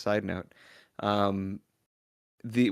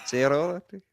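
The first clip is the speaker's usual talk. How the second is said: put-on French accent; 3 semitones higher than usual. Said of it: "none of us can do a French accent".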